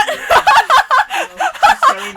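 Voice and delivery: loud, high villainous laugh; evil cackle. Acted. Host reveals her plan with a loud high evil cackle. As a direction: cackle like a madwoman.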